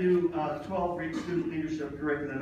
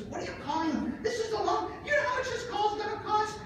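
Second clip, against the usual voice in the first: high-pitched mock wife voice, scolding; playful high wife impression; imitating wife. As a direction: squeak it up like his scolding wife. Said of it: "she goes".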